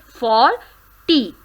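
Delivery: usual speaking voice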